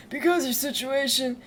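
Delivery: lightly put-on father voice; Artorius Diondal. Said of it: "Can't wait to do his voice".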